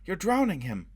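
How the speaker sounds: a high, loud cry of alarm. A narrator's reading. Robin crying out as Foyle drowns the man. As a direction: cry out to stop him. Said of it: "Robin cried".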